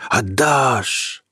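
voiced, rising flame cry. The fire demanding Peter's heart, voiced cry. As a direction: let the fire call out aloud. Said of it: "шумело пламя".

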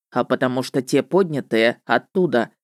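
низкий голос ведьмака; ответь пониже, как ведьмак. A narrator's reading. Реплика ведьмака. (низкий ведьмачий голос)

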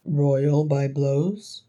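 very slow question rising at the end; questioning disbelief. A narrator's reading. Thorin querying the brothers' claim of royal birth, questioning. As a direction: repeat it slowly, querying every word.

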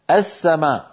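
السماء begun with "a", "as-samaa"; opening fatha. Shown as a demonstration. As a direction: say السماء as "as-samaa", doubled S after an open a. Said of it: "همزة مفتوحة".

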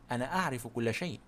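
quietly smug, as if he knows all; empty grandeur. A narrator's reading. Poirot boasts to the lady, softly pompous. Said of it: "بعظمة فارغة".

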